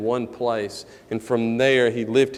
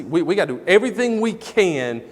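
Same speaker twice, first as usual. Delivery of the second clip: mildly frantic, raised voice, hammering each phrase; urgent resolve; 5 semitones higher, 5 dB louder than usual. Retold or imitated. Father determined to go get his boy, urgent. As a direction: retell it with rising urgency.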